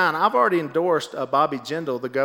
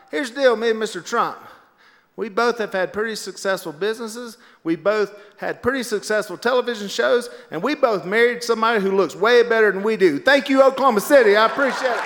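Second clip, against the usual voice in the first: raised pitch, building to a loud sign-off; rally speech; 5 semitones higher, 4 dB louder than usual. Retold or imitated. Willie addresses the Oklahoma City rally, speech building louder. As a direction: address a crowd, louder toward the thanks.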